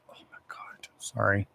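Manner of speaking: hushed, startled whisper; real whisper. Real, not acted. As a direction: drop to a whisper to apologise. Sudden hushed apology.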